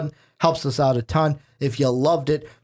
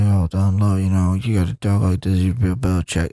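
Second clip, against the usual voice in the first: Bill Belichick impression; 8 semitones lower than usual. Podcast host mocks Bill Belichick for cohost, low impression. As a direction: drop the voice way down like a mumbling coach. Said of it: "That's the Bill Belichick voice".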